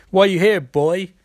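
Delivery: loud, threatening question to the prisoner; demanding shout. Acted. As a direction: lean in and demand it. Canary interrogating a prisoner.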